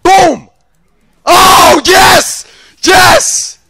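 loud, high whoops; triumphant shout. Real, not acted. Gamer celebrating a kill, triumphant shout. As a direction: roar with joy at each word.

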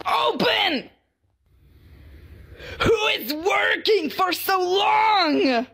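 whine-shout in mock outrage; Shrimpo. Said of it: "play as this little angry shrimp".

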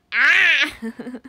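very loud, harsh cawing cry; crow caw. Retold or imitated. Streamer showing chat her crow imitation, loud caw. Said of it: "めっちゃカラスに似てる".

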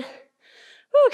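high loud woo of effort; excited woo. Real, not acted. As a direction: whoop to push through the burn. Hyping through a hard set.

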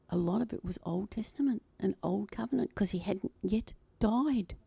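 whispered aside, as if sharing a secret; hushed confession of a daring idea; confiding whisper. Real, not acted. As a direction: lean in and whisper it. Drops to whisper on heresy.

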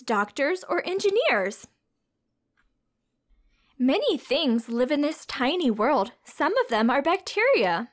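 bright, sing-song storybook read-aloud; reading to children. A narrator's reading. Teacher reading a science picture book to children, storybook voice.

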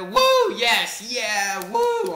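high, loud whooping; cheering. Real, not acted. Celebrating the game win, loud whoops. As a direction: whoop like you won.

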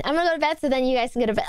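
fast, run-together words; rushed. Real, not acted. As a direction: blurt it out quickly. Rushes repeated line.

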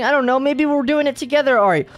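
normal talking voice

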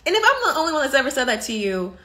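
ordinary delivery

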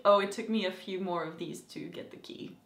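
low, soft, offhand retelling; a hint of a casual villain. Captor boasting about pulling teeth, understated soft menace. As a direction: toss it off quietly, lightly menacing. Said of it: "he's like".

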